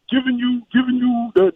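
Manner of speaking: normal talking voice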